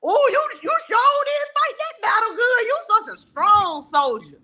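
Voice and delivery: high, loud hammed-up praise for a soldier; mock praise. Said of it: "you be like".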